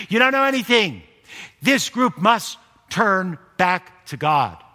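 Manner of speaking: loud, slow preaching to the crowd; preaching at full force. Acted. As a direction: hammer it home from the pulpit.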